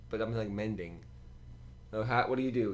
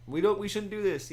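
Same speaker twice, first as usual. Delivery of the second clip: mimicking a whiny player; 10 semitones higher, 5 dB louder than usual. Acted bickering between players.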